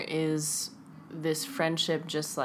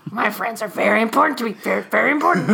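louder, faster creepy parody of a friend; creepy mock voice; 4 semitones higher, 9 dB louder, faster than usual. Cohost parodies friend's line in creepy voice. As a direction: parody him as a creepy stalker. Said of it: "you look very creepy right now saying that".

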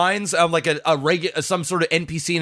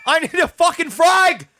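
high-pitched Aussie-Cockney battle shout; muddled Australian-Cockney accent; 11 semitones higher than usual. Shown as a demonstration. Voice actor calls for a frag on request, shouted Australian-Cockney accent. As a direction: yell it like a bad Cockney soldier. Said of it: "give me a frag out".

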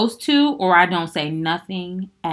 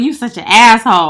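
irritated retort; 4 semitones higher, 14 dB louder than usual. Retold or imitated. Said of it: "And I'm like".

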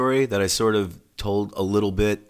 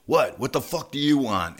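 higher-pitched mock snap at a pest; retold belligerence; 5 semitones higher than usual. Singer turning on a lingering fan, retold belligerence. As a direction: imitate someone itching for a fight, mildly. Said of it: "he was in a fighting mood".

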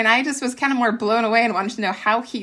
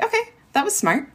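high quick okay of surprise; light mock amazement; impressed; 9 semitones higher, faster than usual. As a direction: sound caught off guard. Mother impressed by son.

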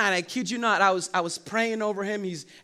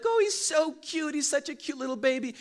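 lightly voiced cooing admirer, higher; cooing adult; 9 semitones higher than usual. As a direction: gush over a baby, a bit higher. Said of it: "they'll be like".

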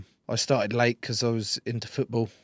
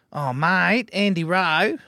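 higher, louder mock Australian phone greeting; Australian accent, breezy and loud; 7 semitones higher, 7 dB louder, much slower than usual. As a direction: ring up in a hammed-up Australian accent. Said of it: "that's my Australian".

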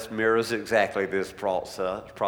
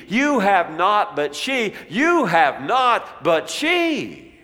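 higher, driving preacher's delivery; forceful preaching; 6 semitones higher, 6 dB louder than usual. Acted. Jesus confronting Simon over the woman, forceful preaching. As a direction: press each contrast home insistently.

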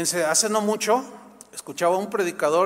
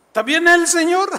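mock surprise; 10 semitones higher, 10 dB louder than usual. Preacher in mock disbelief.

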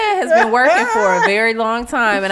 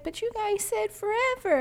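mock tearful little-kid plea, much higher and softer; imitating crying child; 12 semitones higher, 9 dB quieter than usual. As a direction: voice the heartbroken kid pleading. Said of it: "When he was crying".

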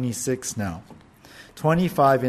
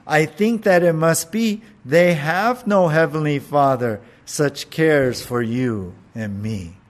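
reciting a little poem, a touch louder; lightly performed poem reading; poem recital; 5 dB louder than usual. Acted. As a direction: read the robin and sparrow verse like a recital. Reads a short poem aloud.

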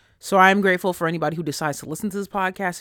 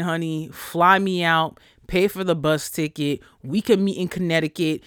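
usual speaking voice

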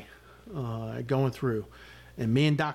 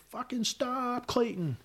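retold urgent order; 10 semitones higher, 3 dB quieter, slower than usual. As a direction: imitate yelling at a driver to stop. Retelling yell to his driver.

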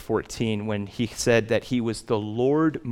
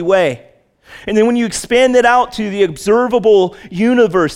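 awed preaching; 8 semitones higher, 6 dB louder than usual. Acted. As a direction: marvel at the size out loud. Awe at star counts, preaching.

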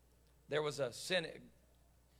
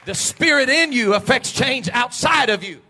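loud, raised preacher's shout; full-force preaching; 6 semitones higher, 16 dB louder than usual. Real, not acted. Preacher urging the congregation to pray, full-force shout. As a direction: drive each line home at full voice.

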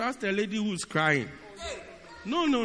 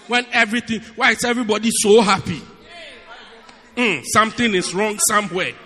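mock suspicion; 9 dB louder, faster than usual. Real, not acted. Preacher mocking the unhappy, loud.

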